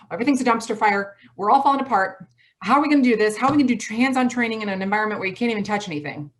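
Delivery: high, loud, fast panicked rant. Pretend. Leader panicking in front of staff, fast frantic rant. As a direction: spiral into mock panic. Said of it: "that's the last thing my staff needed to hear".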